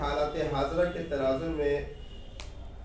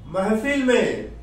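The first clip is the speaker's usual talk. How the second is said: raised declaiming of Urdu verse; mushaira verse recitation; 5 semitones higher, 4 dB louder than usual. Acted. Poet building to a punchline for the crowd, declaimed verse. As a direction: declaim it in phrases, holding back the punchline.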